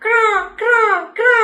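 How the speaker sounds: high imitated crow call; playful crow cawing for babies. Shown as a demonstration. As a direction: caw ക്രാ like a crow for the kids. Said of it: "ഈ ക്രോ എങ്ങനെ കരയുന്നേ?".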